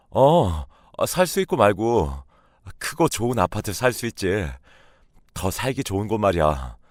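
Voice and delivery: very happy. Acted. Husband assuring wife they can buy a home, delighted. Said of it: "남편이 무척 기뻐했어요".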